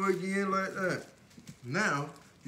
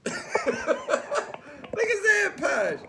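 laughing; 5 dB louder, much slower than usual. Real, not acted. Laughing at partner Posh.

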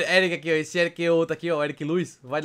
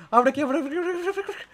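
high frantic repeating; panicked urging; 9 semitones higher than usual. Real, not acted. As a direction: beg the door to open. Panicking at a door.

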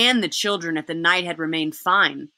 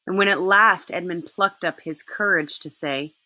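plain reading voice

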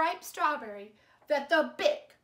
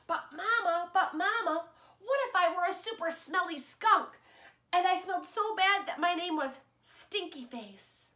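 put-on eager child; 5 semitones higher than usual. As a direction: ask Mama like an excited child. Child pleading to Mama.

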